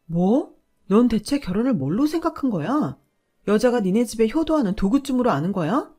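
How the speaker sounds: furious at being treated as a tool; outraged shout. Acted. Wife outraged by husband's insult. (모욕당한 아내가 남편에게, 분노한 외침)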